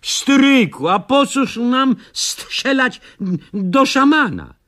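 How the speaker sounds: put-on high, slow, puzzled boy's voice; a naive lad asking his uncle, drawn out; young Józek. Józek asks uncle why shoot shaman, high puzzled voice.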